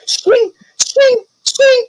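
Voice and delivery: very high, thin arrow zings; zing each arrow in very high; imitated arrow noises. Game master as arrows stick into Hera, high zinging noises.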